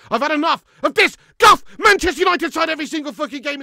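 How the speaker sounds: high, loud outburst of frustration; fed-up shouting. Real, not acted. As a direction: explode at your team, at the end of your patience. Fed up with his team.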